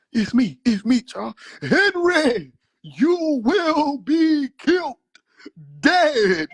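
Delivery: high, loud threatening voice for the uncle; dramatic gun standoff reenactment; acted uncle standoff. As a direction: play both uncles squaring off, rising to a shout.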